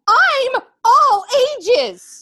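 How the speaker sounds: shouted high and drawn out; defiant yell. Acted. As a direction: protest loudly, slowly. Defiant outburst about being old.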